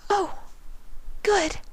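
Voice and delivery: a shy pony, barely daring to speak; Fluttershy voice. A narrator's reading. Fluttershy answers the changeling.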